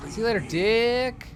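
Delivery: mocking high voice. Mocking enemy, high put-on.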